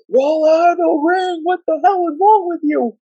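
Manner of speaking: high desperate ringside scream. Retold or imitated. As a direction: scream at him to leave the ring. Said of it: "screaming at R-Truth".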